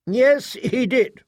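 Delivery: high, drawn-out assured answer; proctor holding forth. Acted. Proctor answers the weapons master, high and deliberate. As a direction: concede it in a high, slow proctor's voice. Narrator voices Wyatt answering.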